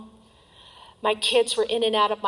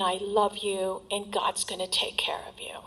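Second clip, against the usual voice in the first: tender whisper; 6 dB quieter than usual. Retold or imitated. Mother softly tells her daughter she loves her, whispering. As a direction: hush it like a loving secret. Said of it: "and she said".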